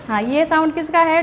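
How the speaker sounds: ordinary talk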